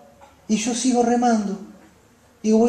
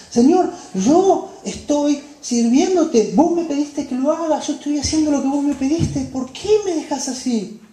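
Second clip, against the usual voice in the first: pleading disciple; 7 semitones higher, 3 dB louder than usual. Acted. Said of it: "Pensarían ellos".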